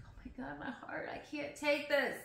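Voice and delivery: hushed, pained reaction; quiet heartbreak. Retold or imitated. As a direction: say it small and overwhelmed. Voices own heartbreak at grandparents' hurt.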